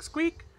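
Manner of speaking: very high little squeak; rat squeak. Acted. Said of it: "you guys hear, like, a squeak".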